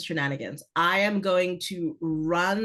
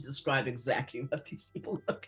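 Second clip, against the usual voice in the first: Liam Neeson; 4 semitones lower, 9 dB quieter than usual. Acted. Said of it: "in a very Liam Neeson way".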